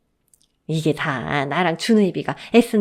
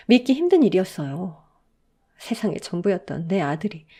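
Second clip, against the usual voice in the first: ordinary delivery, nothing marked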